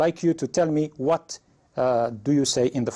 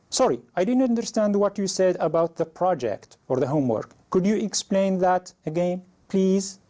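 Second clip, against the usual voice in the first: higher-pitched polite apology; raised, polite demo line; model learner request; 7 semitones higher than usual. Shown as a demonstration. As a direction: play the student asking the teacher to explain again. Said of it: "For example, you could say".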